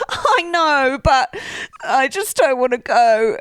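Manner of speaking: whining, drawn-out reluctance; high, slow and tearful; whiny high voice. Retold or imitated. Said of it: "she says".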